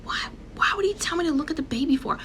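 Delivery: fast, breathless confused whisper; puzzled whisper. Retold or imitated. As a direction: mutter the question to yourself, baffled. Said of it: "And I'm like".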